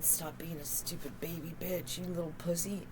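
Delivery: a hint of a harsh inner voice, low and soft; inner critic voice. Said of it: "That's my man dude in me".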